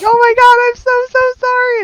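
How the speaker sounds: high, loud panicked apology; frantic apology. Retold or imitated. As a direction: gush out sorry in a flustered rush. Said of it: "And I'm like".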